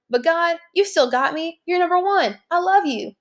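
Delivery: high, gushing imitation of David praising God; mock adoring David. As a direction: voice David switching to cheerful devotion, pitched high. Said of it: "But then he's like".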